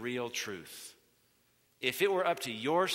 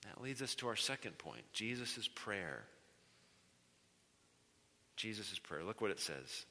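hushed; 4 semitones lower, 9 dB quieter than usual. Real, not acted. Pastor quietly introducing his second point, hushed. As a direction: keep it hushed and weighty.

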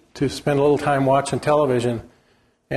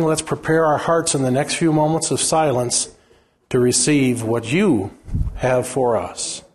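earnest prayer voice, slowing into silence; pastor's prayer. Acted. Pastor praying aloud before the sermon, earnest. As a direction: pray over the congregation, unhurried.

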